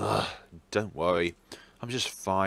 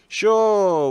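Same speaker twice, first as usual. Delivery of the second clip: sarcastic sure; 10 semitones higher, 11 dB louder than usual. Acted.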